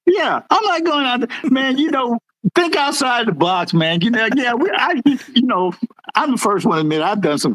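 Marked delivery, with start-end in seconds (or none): 0.1-1.5 mocking impression. Mimicking sergeant's excuse.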